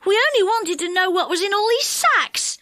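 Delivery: high-pitched, earnest protest; protesting cry. A narrator's reading. Dick explains himself to the enchanter, high protest. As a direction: plead your innocence, crying out. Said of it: "cried Dick".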